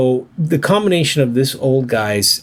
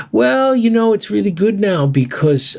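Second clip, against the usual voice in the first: a lightly voiced owner, pitched higher at the start; bar owner's voice; 8 semitones higher than usual. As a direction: lift up high as the old owner, then settle. Bar owner explains his travel.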